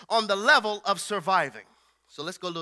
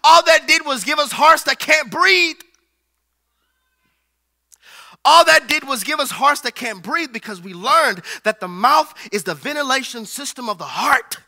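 loud, rising preacher's cry; full-force preaching; 4 semitones higher, 8 dB louder than usual. Acted. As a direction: preach it out to the whole church.

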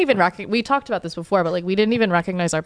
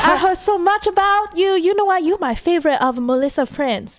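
put-on a lightly drawn Japanese-accented mother; imitating her mom's accent; 9 semitones higher than usual. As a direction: play her mother greeting his friend sweetly. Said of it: "have your mother speak to his mother".

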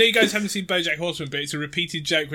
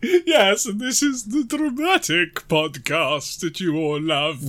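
high, slow theatrical announcer; dramatic voice; 3 semitones higher, 3 dB louder, slower than usual. Pretend. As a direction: ham it up for the crowd. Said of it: "these voices".

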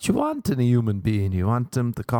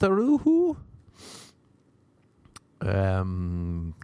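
ordinary talk, nothing marked

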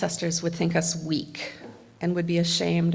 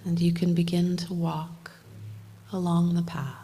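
guided meditation; 4 dB quieter than usual. Acted. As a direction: lead them gently into calm, half whispered. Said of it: "do a little bit of guided meditation".

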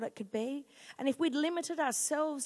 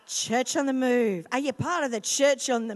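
proclaiming slogan; 8 dB louder than usual. Real, not acted.

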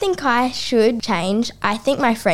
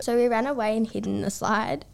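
normal talking voice

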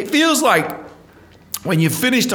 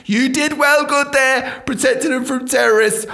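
sarcastic praise; 11 semitones higher, 3 dB louder than usual. Acted. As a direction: scold them with exaggerated praise. Sarcastic praise of failed guards.

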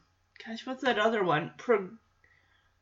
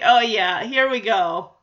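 usual speaking voice

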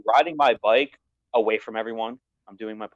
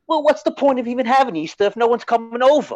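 put-on whiny kid voice; 11 semitones higher, 4 dB louder than usual. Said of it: "He's like".

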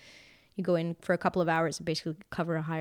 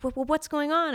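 higher, louder mock alarm; actress asking in alarm; 9 semitones higher, 5 dB louder than usual. Actress in the scene asks what is happening, higher and alarmed. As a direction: play the startled actress. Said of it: "and she's like".

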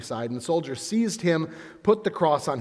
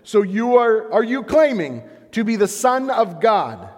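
accusing question; 8 semitones higher, 8 dB louder than usual. Acted. Priests interrogating Jesus.

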